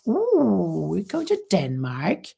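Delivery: ordinary delivery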